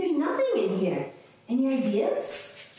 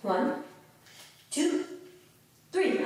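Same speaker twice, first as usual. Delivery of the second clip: ordinary delivery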